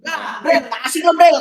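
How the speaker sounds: high-pitched, playful mock complaint; joking Firaun quote. Teacher retells Firaun grumbling to Jibril, high mock complaint. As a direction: whine it as a joke, half laughing. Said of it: "kata dia".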